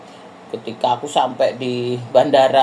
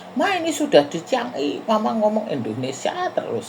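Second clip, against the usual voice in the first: higher, mock child's nagging voice; child scolding mum; 7 semitones higher than usual. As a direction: play the daughter teasing her mother. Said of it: "anakku juga". (menirukan suara anak yang menegur ibunya)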